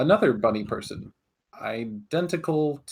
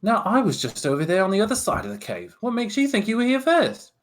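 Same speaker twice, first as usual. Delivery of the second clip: higher, louder, fast rebuttal; indignant retort; 9 semitones higher, 4 dB louder than usual. Acted. Bunny double arguing back, indignant and fast. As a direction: push back hard. Quarrel between twins.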